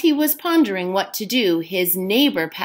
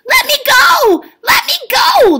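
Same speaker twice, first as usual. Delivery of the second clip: very high, loud squeaky shout; leprechaun shouting; 8 dB louder than usual. A narrator's reading. Caught leprechaun shouting at Jamie to release him, very high and loud. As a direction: shriek to be let go like a caught little man. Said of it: "the leprechaun shouted".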